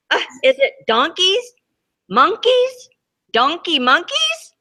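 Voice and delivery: high puzzled question; puzzled guessing. Acted. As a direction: squint at the scribble and guess.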